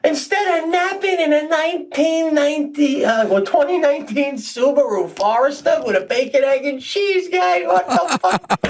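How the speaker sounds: very high, strained disbelief, building as the list grows; incredulous comic rant. Real, not acted. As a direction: spell out the absurd trip in high-pitched exasperation. Mocking friend's absurd journey home.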